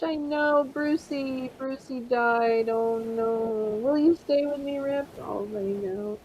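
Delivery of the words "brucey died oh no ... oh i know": ordinary delivery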